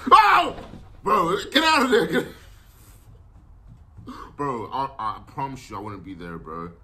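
normal talking voice

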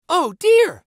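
put-on small boy alarmed by tigers; alarmed gasp. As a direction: startle in fear. Samba frightened by noise.

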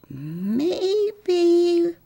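put-on high, drawn-out child's maybe; a lightly voiced boy, unsure; shrugging maybe. Boy shrugging to the others, a high unsure maybe. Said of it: "one of the boys shrugged".

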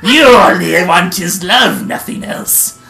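loud, grand boastful declamation; very proud. A narrator's reading. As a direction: puff it up like a pompous rival. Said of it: "says as proudly as he can".

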